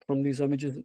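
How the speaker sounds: normal talking voice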